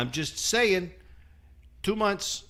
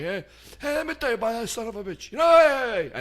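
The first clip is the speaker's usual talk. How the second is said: mock Italian guy, rising higher and louder to the last heys; imitating the Italian painter; 9 semitones higher, 5 dB louder, faster than usual. Mocking the Italian painter gossiping behind his back, loud and high. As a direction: badmouth the boss behind his back, hammed up. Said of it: "'cause I know he's Italian".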